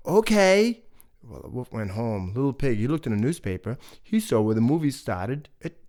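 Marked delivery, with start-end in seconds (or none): 0.1-0.8 cheery okay. Acted. Pig agreeing happily.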